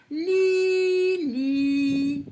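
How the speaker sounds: Lili sung out as two long, high syllables; high, loud, held Li-li. Shown as a demonstration. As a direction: stretch both i sounds in Lili. Teacher stretches the example word.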